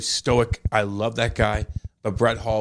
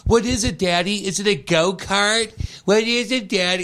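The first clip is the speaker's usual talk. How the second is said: put-on high eager child's voice, higher pitch; excited little kid; 7 semitones higher than usual. Boy begging his dad about the surprise, high and eager. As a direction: play your third-grade self begging dad. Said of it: "I'm like".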